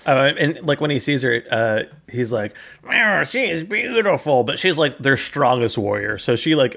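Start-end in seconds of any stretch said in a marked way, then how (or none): 2.9-4.4 high, swooning put-on voice; smitten. Hero first sees the female warrior, smitten. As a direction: gush as if love-struck.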